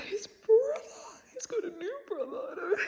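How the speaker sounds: high, choked whisper on the edge of crying; welling up as she talks about it; teary voice breaking. Real, not acted. Vlogger gushing over a favourite book, close to tears. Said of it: "I'm, like, teary-eyed".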